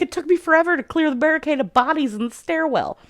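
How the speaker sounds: high-pitched mock grumbling; exaggerated complaint. Garrick grumbles about clearing the stairwell, exaggerated complaint. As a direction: play up the griping.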